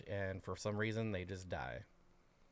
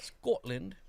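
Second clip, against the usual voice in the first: high, badly faked Scottish accent; failed Scottish accent; 9 semitones higher, 3 dB louder than usual. Asked about the weather in Scotland, tries a failed Scottish accent. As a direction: attempt a Scottish accent and miss. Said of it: "I can't do that accent".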